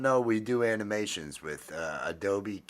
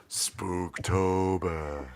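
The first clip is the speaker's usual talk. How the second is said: slow, drawn-out spooky delivery; spooky announcer; 3 semitones lower, much slower than usual. Pretend. Host naming the Halloween month, slow spooky announcement. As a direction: stretch it out like a horror host.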